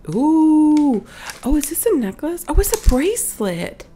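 high, delighted ooh and exclamations; excited discovery. Real, not acted. Vlogger unwraps a bracelet for viewers, excited discovery. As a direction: react with rising, eager surprise.

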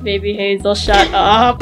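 rude character. Acted. Another character snaps at the baby, low rude voice. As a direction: drop low and tell the baby off.

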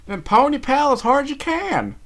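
high, loud command; urgent shout. A narrator's reading. Applejack to her struggling friend in the river, shouting urgently. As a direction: yell hard to drive a swimmer on.

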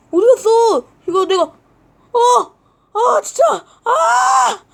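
high, loud mock crying shout; frantic wail. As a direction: wail like a kid whose treat vanished. Frantic over missing cream bread.

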